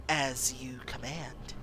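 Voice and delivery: low, slow whispered obedience; eerie servant answer; creature whisper. Acted. Monster obeys in whisper.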